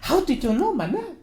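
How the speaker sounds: hammed-up Russian princess; Anastasia.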